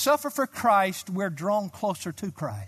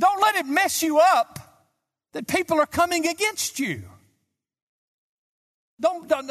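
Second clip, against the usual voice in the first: much higher and louder, urgent; raised urgent sermon voice; fervent preaching; 10 semitones higher, 5 dB louder than usual. Real, not acted. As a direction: urge them not to fear. Fervent paraphrase of Paul.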